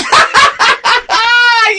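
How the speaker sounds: very high, shrieking laugh; helpless squealing cackle; hysterical laughter. Real, not acted. Breaks into hysterics at the story.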